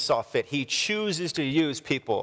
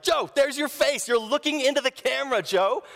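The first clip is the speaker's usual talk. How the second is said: imitating dad confronting; 10 semitones higher than usual. Dad catching his friend on camera, high mock accusing. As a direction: point it out like a dad catching him.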